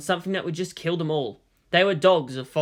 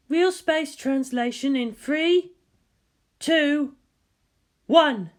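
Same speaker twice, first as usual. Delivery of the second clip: higher, slow, measured countdown call; countdown announcer; 10 semitones higher, much slower than usual. Acted. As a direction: count it down like a bridge officer. Countdown over ship intercom.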